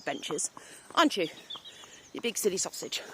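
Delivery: normal talking voice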